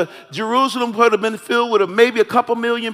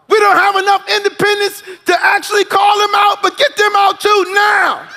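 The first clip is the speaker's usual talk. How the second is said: higher, half-shouted list of wants; mock demands; 6 semitones higher than usual. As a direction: rattle off demands with comic exasperation.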